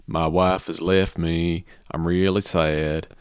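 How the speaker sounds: slow, low mock country drawl; southern accent. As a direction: parody a sad country singer, slowly. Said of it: "I'm singing in a southern accent".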